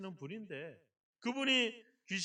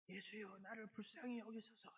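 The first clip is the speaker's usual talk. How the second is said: much softer, barely voiced plea; feeble murmur; 19 dB quieter than usual. Shown as a demonstration. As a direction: murmur the plea so no one could hear. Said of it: "이러면 들어요?".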